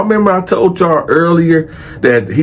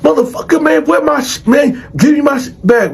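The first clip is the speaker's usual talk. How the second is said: retold screaming; 12 semitones higher than usual. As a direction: imitate angry inmates demanding their things back. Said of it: "other cats screaming at him like".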